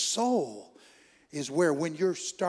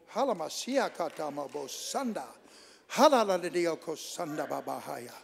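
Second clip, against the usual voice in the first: speaking in tongues. Real, not acted.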